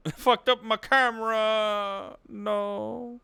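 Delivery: high, drawn-out mock lament; hammed-up cry of dismay, stretched out; mock wail. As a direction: wail it slowly in exaggerated despair. Mock lament over broken camera.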